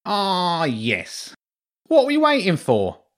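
high, brisk, self-important rat; pompous rat lord. A narrator's reading. Huge rat lord impatient with his soldiers. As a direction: hurry them along like a fussy lord.

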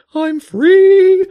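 high, loud bird coo; high pigeon voice, carefree as it plummets. Acted. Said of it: "cooed the pigeon".

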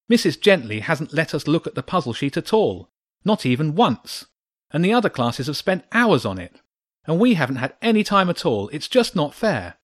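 upset protest. A narrator's reading. Boy protests unfairness to teacher.

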